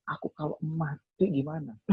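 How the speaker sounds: small, high voice of retold dread; retold fear. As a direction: imitate anxiously asking God. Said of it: "Wah, aku ketakutan banget".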